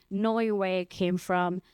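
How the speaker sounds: ordinary talk, nothing marked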